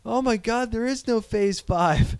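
high, fast outraged disbelief; mock outrage. Host rants at Marvel in pretend dismay, high and outraged. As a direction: ham up the betrayal.

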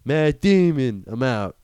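space crazy. Retold or imitated. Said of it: "I've gone space crazy".

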